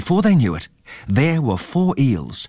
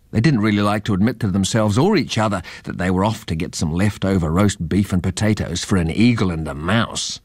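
ordinary delivery, nothing marked

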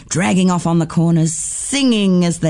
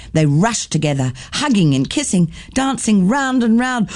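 ordinary delivery

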